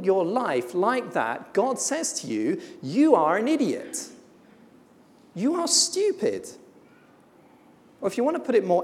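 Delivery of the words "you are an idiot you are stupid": blunt rebuke. Acted. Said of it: "I want to be no less blunt".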